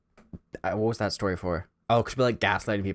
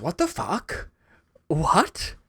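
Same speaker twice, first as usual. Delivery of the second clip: put-on Howard's accent, minimal effort; 10 semitones higher, much slower than usual.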